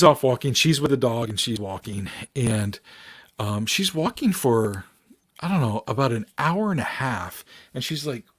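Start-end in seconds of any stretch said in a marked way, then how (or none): none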